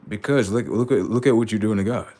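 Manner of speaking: usual speaking voice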